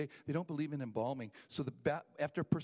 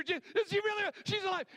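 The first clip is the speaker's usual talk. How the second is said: much higher and louder, ecstatic; joyful shout; 7 dB louder than usual. Retold or imitated. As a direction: scream it out with joy. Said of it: "jumping for joy".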